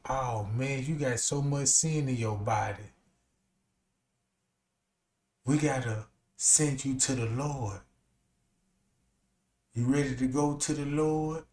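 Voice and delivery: mock preacher. Preacher telling a sinner he must go, low and ominous. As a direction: murmur it like a sinister preacher. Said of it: "the preacher tell you".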